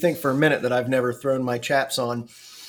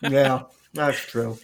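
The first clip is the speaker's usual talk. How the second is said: mouth full; much slower than usual. Real, not acted. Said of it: "Sorry, I'm eating a Crunch Wrap".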